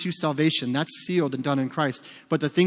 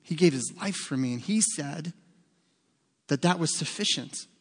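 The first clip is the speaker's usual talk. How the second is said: humble quiet answer; 5 dB quieter than usual. Retold or imitated. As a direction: say it simply, almost hushed. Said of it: "could you imagine just saying".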